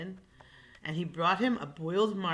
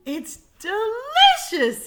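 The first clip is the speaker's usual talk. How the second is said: slow, high and loud in rapture; ecstatic lion; 13 dB louder, slower than usual. Acted. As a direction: draw it out in bliss, much higher and louder.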